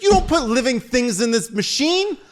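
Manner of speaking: high, loud mock tirade; angry agent's scolding. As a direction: yell in outrage, imitating the agent. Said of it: "that T S A agent was so angry".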